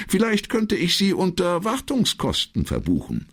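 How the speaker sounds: secretary figure. Acted. Secretary answers the commissario's question, high-pitched. Secretary's line voiced in the novel.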